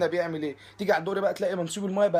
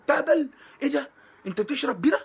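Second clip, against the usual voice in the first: high shocked outburst, louder; mock shock; 8 semitones higher, 5 dB louder than usual. Scene: comic scolds a man drinking beer, mock shocked. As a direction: act scandalized at the beer.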